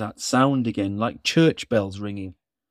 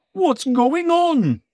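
startled Santa; 4 dB louder, slower than usual. Acted.

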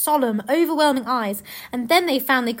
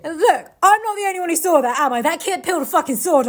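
higher, louder protest; mock indignant Aslan; 5 semitones higher, 5 dB louder than usual. Aslan protesting to onlookers, indignant and louder. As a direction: plead your case to the crowd.

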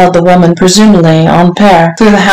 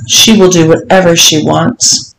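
plain reading voice

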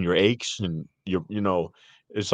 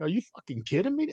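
higher, disbelieving cry at the TV ad; incredulous outburst; 9 semitones higher, 4 dB quieter than usual. Retold or imitated. Reacting to a twitch-pill commercial, incredulous and higher. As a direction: scoff in total disbelief. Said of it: "I'm like".

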